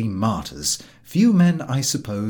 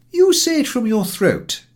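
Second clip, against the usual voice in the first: painstaking German teacher; 13 semitones higher, 7 dB louder than usual. A narrator's reading. German teacher drilling his pupil on Kirche, insistently.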